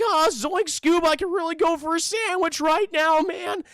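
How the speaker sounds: high, loud goofy slacker; Shaggy. Retold or imitated. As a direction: put on the cartoon stoner's voice. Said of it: "it's like".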